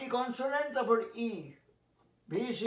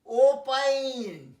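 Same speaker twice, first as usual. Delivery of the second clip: slow demo; 4 dB louder, much slower than usual. Shown as a demonstration. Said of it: "slow teaching demo".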